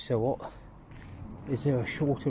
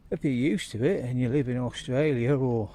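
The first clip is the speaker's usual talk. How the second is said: usual speaking voice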